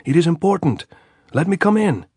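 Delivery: high, loud answer across the wire; shouting. A narrator's reading. Andres answering the sentry's challenge, shouting. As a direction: yell it up so they hear. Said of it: "Andres shouted".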